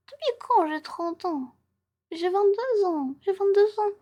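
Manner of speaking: high, loud, drawn-out protest; mock outraged cry. Streamer insisting she is twenty-two to teasing chat, mock outraged. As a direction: wail it out in exaggerated indignation. Teased as being thirty.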